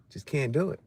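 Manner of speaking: usual speaking voice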